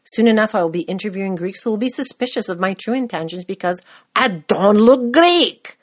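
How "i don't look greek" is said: loud, high, drawn-out mimicry; hammed-up accusing cry; mock indignant objection. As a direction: blare it slowly, imitating the doubters. Mimics suspicious Greeks' loud objection.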